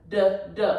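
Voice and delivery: "d, d" as the bare sound duh, duh; "d, d" tapped out as two short consonant sounds; isolated consonant sound. Shown as a demonstration. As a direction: repeat "d, d" as separate end sounds, not letter names. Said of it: "ends in a constant sound".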